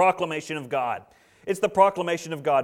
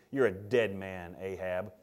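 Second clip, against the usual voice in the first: low, slow, very soft sentence of death; grave verdict; 8 semitones lower, 8 dB quieter, slower than usual. Acted. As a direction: pronounce the doom quietly and deliberately. Prophet pronounces the king's doom.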